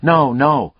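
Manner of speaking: urgent. Acted. Detective urging the killer to hear him out, urgent and loud. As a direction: press him hard to listen. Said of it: "Lucas said urgently".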